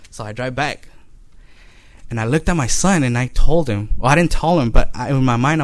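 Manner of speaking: usual speaking voice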